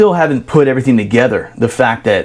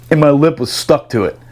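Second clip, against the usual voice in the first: usual speaking voice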